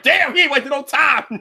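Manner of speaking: laughing shout. Retold or imitated. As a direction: blurt it out laughing in shock. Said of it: "I'm like".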